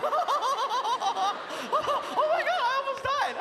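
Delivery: high, laughing near-hysteria; hysterical laughing. Retold or imitated. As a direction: giggle through the shock. Hysterical after near miss.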